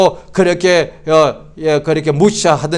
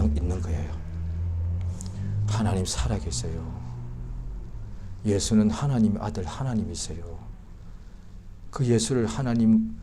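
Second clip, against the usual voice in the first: normal talking voice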